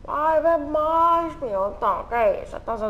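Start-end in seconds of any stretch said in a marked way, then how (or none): 0.0-1.4 high, loud, slow urging voice; mock pushy urging. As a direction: egg him on to drink. Urging drink in a voice.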